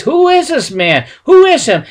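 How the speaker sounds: furious yelling. Retold or imitated. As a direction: shout down the insult. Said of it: "yelling. They were just mad".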